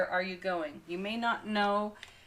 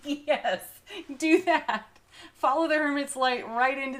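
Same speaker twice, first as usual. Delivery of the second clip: loud, high, eager encouragement; excited urging; 7 semitones higher, 7 dB louder than usual. Real, not acted. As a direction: cheer the listener on with joy. Urging viewer, thrilled.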